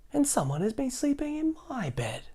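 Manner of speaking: a fussy mama bear; high motherly bear. Acted. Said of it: "said the mama bear".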